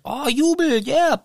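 high excited cheering; squeaky mock jubilation. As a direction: cheer it like a happy crowd.

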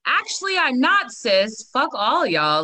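sudden high, loud, slow mock declaration; defiant declaration. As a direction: throw it out like a bold announcement. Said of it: "I didn't just wake up and was like".

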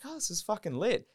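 high-pitched thrill, rising at the end; retold excitement. Recalling being offered the booth, excited.

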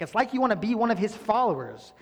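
high, loud mock-sarcastic jab. Healed man mocking the Pharisees, sarcastic. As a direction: needle them sarcastically. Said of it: "he says a very sarcastic comment".

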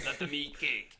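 lightly put-on French accent; bad French accent. Quoting Marie Antoinette for laughs, bad French accent. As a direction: try a poor, half-hearted French accent. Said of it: "that's just a bad French accent".

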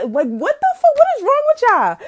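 high incredulous shout; exasperated outburst. Real, not acted. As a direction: throw your hands up at them.

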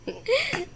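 a burst of high, breathy laughter. Real, not acted. Reacting to friend's tease.

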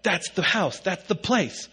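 imitated high-pitched frantic exclamation; freaking out. Wife spots the dream house from the car, freaking out. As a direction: mimic her excited outburst, voice jumping way up. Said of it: "she starts freaking out in the car".

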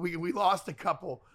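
ordinary delivery

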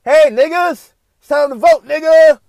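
a loud, hyped mock of a campaign pitch; mocking pandering ad. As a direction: parody a pandering get-out-the-vote ad. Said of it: "don't reach out to me this way and be like".

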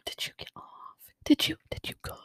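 hushed, breathy prying questions; nosy whisper. Retold or imitated. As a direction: whisper it like juicy gossip.

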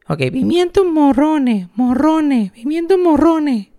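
put-on high, slow, anxious child rehearsing the words; a scared boy drilling the phrase; nervous little kid. Little boy practicing, scared of getting it wrong. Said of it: "bien asustado de que no lo voy a decir bien".

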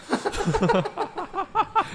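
high-pitched helpless laughter; real laughing fit. Real, not acted. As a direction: crack up at your own punchline. Cracking up at his own story.

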